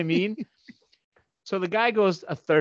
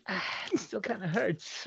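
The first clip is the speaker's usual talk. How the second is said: sister's whimper; 4 semitones higher, 11 dB quieter than usual. Retold or imitated. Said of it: "She's like".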